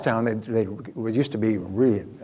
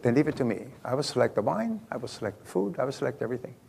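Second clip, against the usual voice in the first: put-on lightly Italian-accented, softer waiter voice; 6 dB quieter, faster than usual. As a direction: imitate an Italian waiter, softly. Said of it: "very friendly, charming, and he had an Italian accent".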